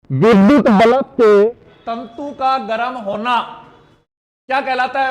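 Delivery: विद्युत बल्ब के projected loudly, then a pause; emphatic rereading. Shown as a demonstration. Teacher rereads the quiz question to the class, loud and emphatic.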